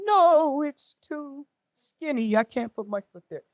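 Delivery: high, drawn-out mock wail; protesting. Acts out panicked refusal to helper.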